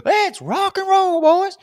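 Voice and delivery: put-on coffee-buzzed shout to the boys; over-caffeinated battle cry; hyped whoop. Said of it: "I'm like".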